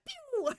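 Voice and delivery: high, sharp imitated laser zap; cartoon laser pew from the palm. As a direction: fire off a cartoon laser noise. Laser sound effect.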